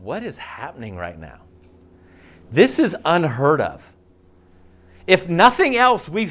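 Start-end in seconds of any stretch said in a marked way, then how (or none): 0.0-1.4 near-whispered, stunned question; a stunned murmur in the silent room; hushed awe. Retold or imitated. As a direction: ask it under your breath, awestruck. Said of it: "There would be a level of silence".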